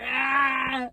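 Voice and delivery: long drawn-out imitation roar; mock roar. Mocking gym lifters' noise, drawn-out roar. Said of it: "Do the roar".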